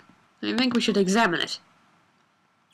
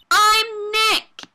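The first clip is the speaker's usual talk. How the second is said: louder and higher, calling out the name; 10 semitones higher, 7 dB louder than usual. Shown as a demonstration. Introducing himself loudly to the camera, calling out. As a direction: call it out so the camera hears. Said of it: "maybe I'm not saying it loud enough".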